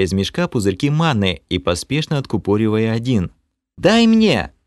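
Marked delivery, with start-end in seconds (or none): 3.8-4.5 much higher and louder cry; demanding shout. A narrator's reading. Kalen shouts for mana while casting, urgent. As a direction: yell for the potion urgently. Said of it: "крикнула продолжающая магичить Кэлен".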